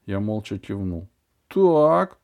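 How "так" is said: a loud, high, angry bark. A narrator's reading. Dentist loses her temper with the boy. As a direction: cut in with fury. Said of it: "разозлилась она и закричала".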